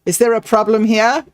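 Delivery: ordinary delivery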